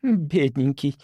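patronizing pity; poor thing, talk down; condescending. Acted. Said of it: "снисходительно похлопала".

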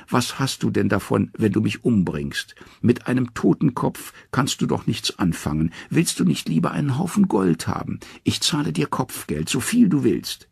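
high pleading trickster voice; wheedling Loki. Acted. Loki coaxing the angry dwarf to take gold, high and wheedling. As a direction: sweet-talk the dwarf out of it.

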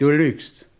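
angry accusation. Acted. Accuses Josh of lying.

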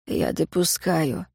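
slow, soft admission; conceding. A narrator's reading. Ignat granting she may be innocent, quietly conceding. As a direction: allow it quietly, without hurry.

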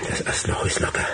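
hushed, awed whisper; whispered discovery. Acted. Man finding the loose knothole, whispering in awe. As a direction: breathe it out, amazed.

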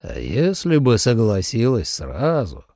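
slow, low reproach; reproachful. A narrator's reading. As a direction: chide her gently but firmly. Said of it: "укоризненно сказал".

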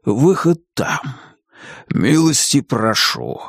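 slow, drawn-out dragon voice; polite storybook dragon; courteous dragon. A narrator's reading. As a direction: usher guests out, unhurried. Dragon showing guests the exit.

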